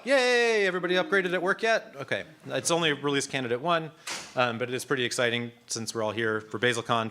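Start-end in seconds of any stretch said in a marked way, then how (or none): 0.0-2.0 high, louder celebratory whoop to the room; excited, high-pitched hurrah; playful cheer. Real, not acted. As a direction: cheer the news, pitched well up. Cheers the new release to audience.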